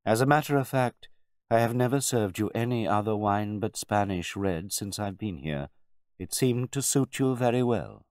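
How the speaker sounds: polite but emphatic butler; composed butler. A narrator's reading. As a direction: explain it frankly, keeping your dignity. Butler confesses to his master.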